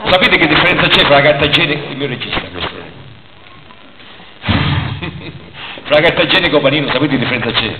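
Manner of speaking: normal talking voice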